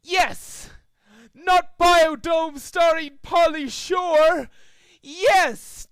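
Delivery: high, loud, slow triumphant yell; gleeful shout. A narrator's reading. Trixie holding up the DVD, shouting high and loud. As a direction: crow over him.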